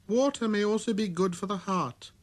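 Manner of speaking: put-on high, light boyish voice; a small boy's clear greeting; little prince voice.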